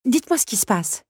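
high-pitched, insistent demand; suspicious demand. A narrator's reading. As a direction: demand an answer in a lifted voice. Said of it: "réclama-t-elle".

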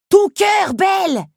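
high, very loud urgent yell; insistent shout. Acted. Scarab insists to Belle, shouting urgently. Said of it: "insista Lucanos".

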